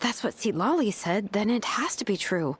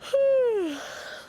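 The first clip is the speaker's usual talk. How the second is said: sleepy sigh; 12 semitones higher than usual. Acted. Sleepy companion sighs.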